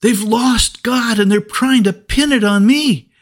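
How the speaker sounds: put-on scared little boy gasping for help; panicked boy crying out. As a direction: cry out to your big brother in terror. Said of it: "he gasped".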